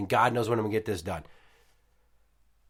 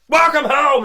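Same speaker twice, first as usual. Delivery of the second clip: loud, high surprise yell; shrieked greeting to startle; jump-scare shout; 12 dB louder than usual. Retold or imitated. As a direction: leap out and scare her.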